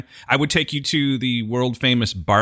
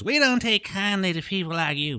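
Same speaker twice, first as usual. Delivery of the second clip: higher put-on Texas accent; 6 semitones higher, 4 dB quieter than usual. Quoting a Texan warning off strangers, mock Texas accent. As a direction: imitate an old-time Texas local. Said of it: "people from Texas talk that way".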